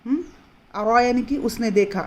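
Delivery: ordinary talk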